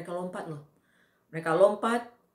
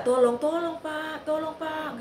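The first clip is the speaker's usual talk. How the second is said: high, slow pleading cry; imitating her begging please sir; retold desperate plea; 10 semitones higher, 3 dB louder, slower than usual. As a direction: plead with the attackers, voice pitched up. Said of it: "Saya minta".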